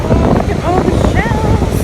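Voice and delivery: loud, thrilled exclamation; excited. Real, not acted. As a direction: gush over the shells. Excited by shells.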